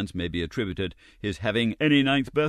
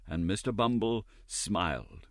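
usual speaking voice

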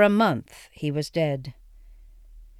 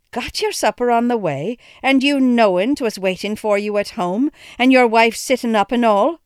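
mother's raised, reproachful voice; reproachful cry; 5 semitones higher, 6 dB louder than usual. A narrator's reading. Mother scolding father for skipping supper, reproachful cry. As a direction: scold him for eating out. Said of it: "cried my mother reproachfully".

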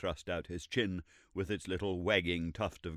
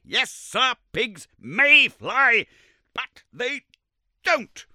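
very slow, much higher and louder shout, "may" hammered; furious mockery; 13 dB louder, much slower than usual. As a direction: ram the mockery home. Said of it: "cried Summerlee furiously".

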